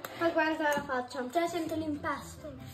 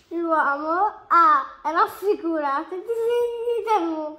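crying while reading; 9 dB louder, slower than usual. Real, not acted. Child reading, upset over homework.

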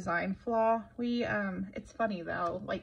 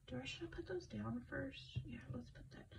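breathy whisper to someone off camera; whispered aside; 4 semitones higher, 14 dB quieter than usual. Real, not acted. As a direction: drop to a whisper, a quick aside.

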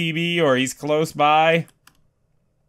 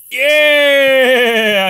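triumphant yell; 5 semitones higher, 7 dB louder than usual. Real, not acted. Triumphant yell after stealing TV.

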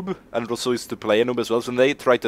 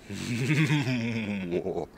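creepy laugh; 5 dB quieter than usual. Pretend. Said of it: "I love being creepy".

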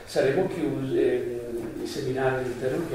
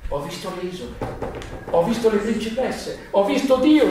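reproachful tone; 6 semitones higher than usual. Acted. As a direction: throw it at him as an accusation. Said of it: "in tono di rimprovero".

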